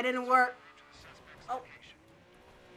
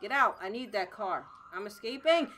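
ordinary talk, nothing marked

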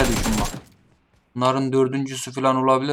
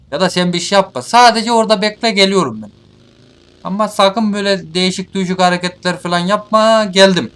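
raised voice, high and loud; loud taunting warning; 6 semitones higher, 6 dB louder than usual. Real, not acted. Player orders cornered enemy to wait there, loud warning. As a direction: shout a warning across to the opponent.

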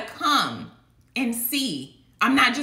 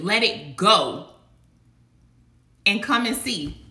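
usual speaking voice

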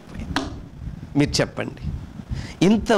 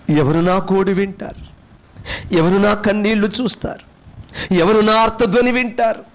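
despairing lament; 5 semitones higher than usual. Acted. Preacher voicing an abandoned sinner, building despairing lament. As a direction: voice the forsaken soul, climbing in anguish. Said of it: "అనుకున్నప్పుడు".

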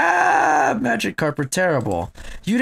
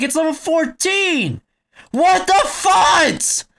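high, loud furious yelling; gamer rage; 11 semitones higher, 5 dB louder, much slower than usual. Real, not acted. Losing to a Miltank, high furious yelling. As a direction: scream at the game in frustration.

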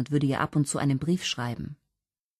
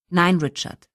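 forceful but restrained no; mühsam beherrscht; 3 semitones higher, 6 dB louder, slower than usual. A narrator's reading. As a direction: hold onto your composure, a touch louder. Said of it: "bemühte sich, nicht die Fassung zu verlieren". (mühsam beherrscht, mit Nachdruck)

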